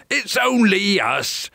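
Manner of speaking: high, placating group cry; pirates crying out. A narrator's reading. Pirates answer the giant, high cry. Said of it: "they cried".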